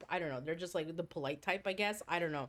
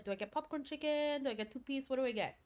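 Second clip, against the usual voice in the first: mock fretting, higher-pitched chatter; imitating anxious dithering; 5 semitones higher, faster than usual. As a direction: imitate a friend dithering over options. Acting friend dithering over her order.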